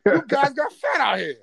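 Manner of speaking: lightly acted gangster-film line; drawn-out, mock-tough movie line; movie quote. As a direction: recite it like a crime movie quote. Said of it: "I start quoting lines from".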